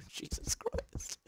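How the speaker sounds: slow breathless whisper after a scare; shaken whisper. Real, not acted. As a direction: gasp it out, still shaken. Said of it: "That was really frightening".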